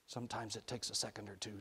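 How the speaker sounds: soft, hushed aside; quiet murmur to a child; whispered. A narrator's reading. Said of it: "Rennie whispered".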